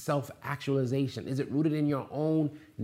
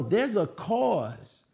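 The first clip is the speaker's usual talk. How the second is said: ordinary talk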